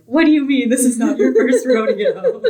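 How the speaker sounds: high, incredulous rise on first rodeo; stunned echo of the elders, mock incredulous. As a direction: echo it back in disbelief. Sydney and Theo stunned.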